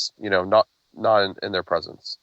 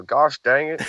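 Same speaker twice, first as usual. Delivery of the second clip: light Oklahoma twang on "Gosh dang it"; Oklahoma accent; 5 semitones higher than usual. Shown as a demonstration. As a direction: drop into a folksy Oklahoma accent. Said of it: "my Oklahoma accent will come out. I'll go".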